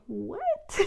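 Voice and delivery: high, baffled "What?"; a startled squeak of confusion; mock-baffled what. Baffled reaction to the card.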